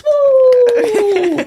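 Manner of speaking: long, high, loud woo; cheering whoop. Real, not acted. Host leading applause for tax breaks, whooping. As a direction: whoop it up and hold it.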